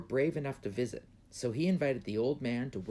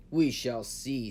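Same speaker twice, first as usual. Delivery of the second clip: slow, loud, measured threat; emperor's slow warning; 7 dB louder, slower than usual. A narrator's reading. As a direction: draw it out, ominous. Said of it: "said the emperor".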